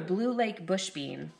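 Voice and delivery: ordinary delivery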